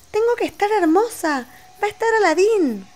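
high, dreamy princess; high girly princess voice. Pretend. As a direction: play the vain princess. Jasmine doll speaking.

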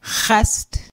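"chast." with a rasping h deep in the throat; "chast." with a voiceless guttural rasp. Shown as a demonstration. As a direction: scrape the start of "chast." at the back of the throat. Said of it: "guttural H deep in the back of the throat".

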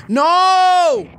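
loud, high, drawn-out NO; dismayed shout. Real, not acted. Play fails in game.

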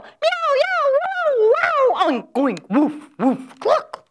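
high, loud chorus of animal cries; animal noises. Acted. Storyteller voicing a noisy farmyard hubbub. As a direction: squeal out each farm animal in turn.

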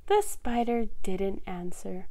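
ordinary delivery

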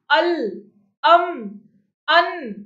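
अल, अम, अन as separate sounds, a brief vowel into held L, M, N; syllabic consonant demo. Shown as a demonstration. Teacher telling students to notice the sounds, अल, अम, अन isolated and distinct. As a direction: sound out अल, अम, अन one at a time. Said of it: "इनकी sound क्या आती है".

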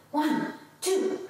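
normal talking voice

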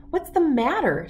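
high, pointed bully's question; narrated schoolboy's challenge; demanding. A narrator's reading. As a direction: demand an answer, pitched well up. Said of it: "Spider demanded".

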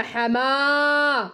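stretched very slowly, the meem held in the middle; slow model word. Shown as a demonstration. Teacher models the word for Nizar, drawn-out slow. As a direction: draw the word out for the child to copy. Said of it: "حرف الميم باللون الأحمر في وسط".

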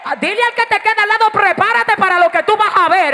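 loud, high-pitched exhortation to the congregation; full-force preaching. Real, not acted. Preacher rousing the congregation about something new coming, full-force preaching.

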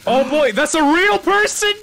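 high, loud surprised yelp; excited shout. Real, not acted. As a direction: yell it out in shock. Reacting to game character.

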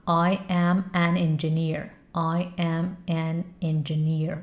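slow full-form practice; first say it slowly, word by word. Shown as a demonstration. Teacher showing the slow first practice step. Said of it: "بعد تندترش کن".

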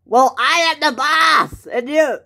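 high, loud, indignant shout; yelling boss. Acted. Boss pulling rank on the worker, yelling. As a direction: pull rank at full volume. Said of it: "he yells at me a lot".

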